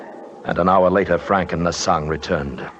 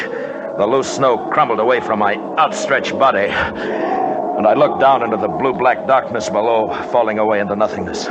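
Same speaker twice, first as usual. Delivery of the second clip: dramatic story narration; 3 semitones higher than usual. A narrator's reading. Narration of the fall.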